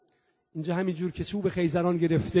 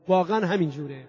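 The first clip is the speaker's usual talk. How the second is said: ordinary talk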